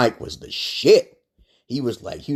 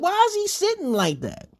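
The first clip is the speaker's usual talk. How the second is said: much higher incredulous question; retold disbelief. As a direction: ask it like you can't believe your eyes. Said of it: "I said".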